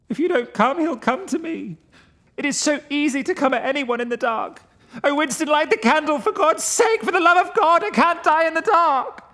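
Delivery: high, loud desperate pleading; terrified begging. Acted. Frightened friend begging Winston to light the candle, desperate. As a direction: plead as if death is coming.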